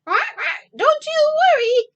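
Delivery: high, loud duck mother voice; Mrs. Mallard. Acted. Mrs. Mallard reassures her husband, high and loud. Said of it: "said Missus Mallard".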